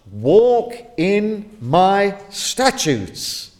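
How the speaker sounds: slow build, questioning then proclaiming; slow, drawn out. Acted. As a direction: stretch each word of the verse out. Preacher drawing out scripture.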